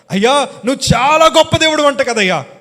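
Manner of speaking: high, loud crying out to God; desperate tearful prayer. Retold or imitated. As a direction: cry out to Jesus in anguish. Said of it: "ఏడుస్తూ వణుకుపోతూ అడుగుతున్నాను". (ఏడుస్తూ దేవునికి మొర)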